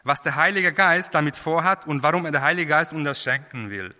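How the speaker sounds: usual speaking voice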